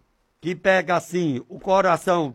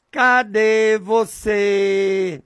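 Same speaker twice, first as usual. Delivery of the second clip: long, stretched call to God; drawn-out calling; 3 semitones higher, 4 dB louder, much slower than usual. Shown as a demonstration. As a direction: call out slowly, holding each vowel. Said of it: "Eu digo desse jeito". (chamado longo e arrastado)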